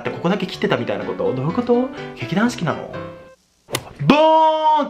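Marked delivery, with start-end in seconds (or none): 4.1-4.9 loud high "ta-da" burst; excited reveal. Acted. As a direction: announce the reveal with a bang. Big makeup reveal moment.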